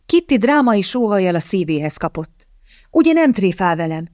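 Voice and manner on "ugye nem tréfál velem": ordinary delivery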